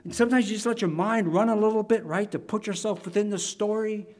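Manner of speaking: usual speaking voice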